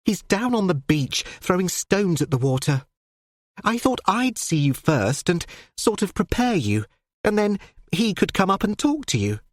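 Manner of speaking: earnest daughter explaining to her father; young daughter's voice. Acted.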